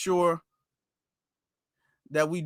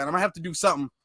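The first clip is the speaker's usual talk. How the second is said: ordinary talk, nothing marked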